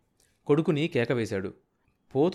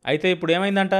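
scornful question, raised and louder; contemptuous; 6 semitones higher, 6 dB louder, faster than usual. A narrator's reading. Sambayya asking the man he despises what of it, contemptuously. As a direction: dismiss him as if he were vermin. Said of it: "పేడ పురుగును చూసినట్టు".

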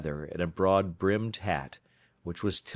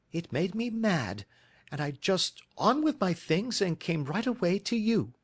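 higher, a touch of mild indignation; Mary, indignant; 10 semitones higher than usual. A narrator's reading. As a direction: tell it with girlish grievance. Narrator voices Mary, indignant.

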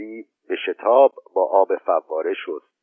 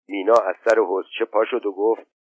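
ordinary delivery

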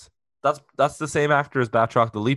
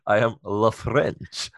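slow, drawn-out mock French villain accent; bad French accent; much slower than usual. Host redoes the French villain after laughing, bad French accent. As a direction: stretch it in a botched French accent. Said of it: "that was a bad French".

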